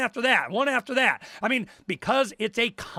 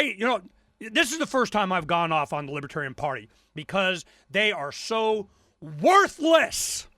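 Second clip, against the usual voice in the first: ordinary talk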